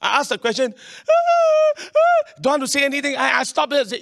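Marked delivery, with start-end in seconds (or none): 1.1-2.4 high loud mock sob; imitated crying wail. Pastor mimics daughter crying again, high mock wail. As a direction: howl like a crying child.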